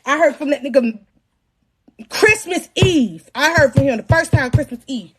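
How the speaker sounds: usual speaking voice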